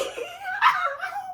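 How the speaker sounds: sudden high-pitched laugh breaking out; high burst of laughter. Real, not acted. Teller cracking up retelling the nurse's question, high laugh. Laughing at psych ward reveal.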